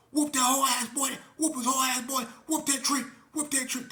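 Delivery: high loud chant; shouted crowd chant; egging on. Retold or imitated. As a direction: cheer on the fight.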